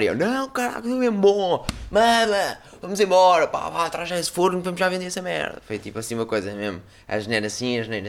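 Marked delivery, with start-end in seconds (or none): 0.2-5.6 much higher, eager pleading; excited insisting. Retold or imitated. Kid urging his aunt to bring the oven, excitedly high. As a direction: push the aunt, bursting with excitement. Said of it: "todo puto excitado".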